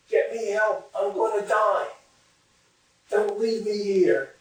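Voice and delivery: high, pleading cry for help; storyteller's restrained scream, pitched high; mock scream. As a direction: act out a trapped man calling up. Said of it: "he starts screaming up at them".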